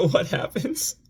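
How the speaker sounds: laughing. Real, not acted. Rueful laugh at bad luck.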